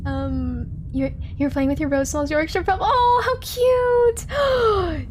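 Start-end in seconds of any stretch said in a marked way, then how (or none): 2.8-5.1 high delighted squeal over a puppy; gushing. Real, not acted. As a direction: melt over something adorable. Chat mentions a Yorkshire puppy.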